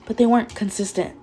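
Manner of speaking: plain reading voice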